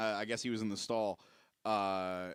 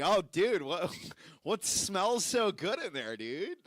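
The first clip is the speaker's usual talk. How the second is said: higher, drawn-out mock wonder; mock enthusiasm; 6 semitones higher, 3 dB louder, slower than usual. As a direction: tease him with exaggerated delight. Replays prankish question to man leaving stall.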